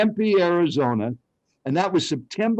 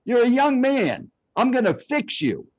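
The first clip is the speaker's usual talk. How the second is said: retold yell; 7 semitones higher than usual. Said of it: "And then he yelled at me".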